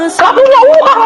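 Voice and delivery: wry exclamation. Real, not acted. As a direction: blurt it out high as if half laughing at it. Exclaims about having no money.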